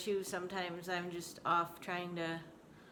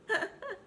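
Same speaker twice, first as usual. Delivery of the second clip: laugh. Real, not acted. Laughing at pet.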